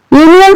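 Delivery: यूनियन begun with a consonant "yu" sound; y-sound pronunciation. Shown as a demonstration. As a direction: say यूनियन starting "yoo", not with a pure vowel. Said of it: "लेकिन इसका उच्चारण". (यूनियन का उच्चारण "य" ध्वनि से शुरू)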